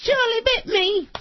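put-on high, slow child's whine; childlike voice. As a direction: mimic a little kid. Quoting viral video child.

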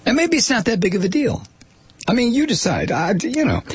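high-pitched sarcastic downplaying; mock nonchalance. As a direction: shrug it off in a high, put-on voice.

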